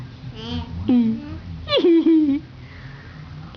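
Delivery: warm burst of laughter; laughing. Real, not acted. Laughter after question.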